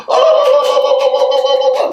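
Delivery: very high, loud cheering "Oh!"; huge crowd whoop; crowd roar. Retold or imitated. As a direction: imitate a whole room erupting. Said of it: "they all jump to their feet instantly and go".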